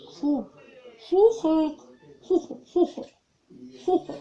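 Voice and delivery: commands mumbled through a stuffed mouth; mouth full. Real, not acted. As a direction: mouth crammed full, words garbled. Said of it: "Сложно говорить".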